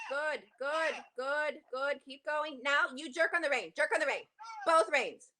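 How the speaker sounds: usual speaking voice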